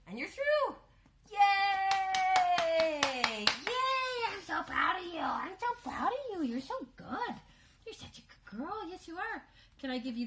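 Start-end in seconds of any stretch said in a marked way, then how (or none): 0.1-6.5 high, loud joyful praise; excited cheering. Real, not acted. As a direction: celebrate the finish loudly. Dog finishes course.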